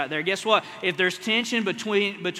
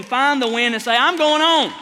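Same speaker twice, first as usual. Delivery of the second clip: louder and higher, declaring I'm going on; rousing shout; 6 semitones higher, 9 dB louder than usual. Real, not acted. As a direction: call it out in triumph. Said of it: "rousing climax".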